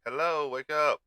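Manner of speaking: loud, sharp wake-up call; a frustrated streamer yelling at his frozen computer; shouting at computer. Real, not acted. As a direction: yell at the machine to wake up.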